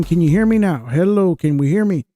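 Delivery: usual speaking voice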